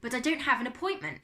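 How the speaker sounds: understated stammering protest; stammered. A narrator's reading. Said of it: "he stammered".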